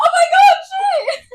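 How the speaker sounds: high, loud laughing shriek; panicked shout. Retold or imitated. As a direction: yelp it in a panic while laughing. Retold panic exclamation.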